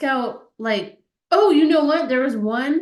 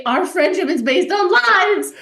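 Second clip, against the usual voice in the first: slow, loud, high hammed-up accusation; table-flipping fake fury, drawn out; mock outrage; 7 semitones higher, 8 dB louder than usual. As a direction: bellow it like a betrayed friend flipping a table. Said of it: "flip the table over and say".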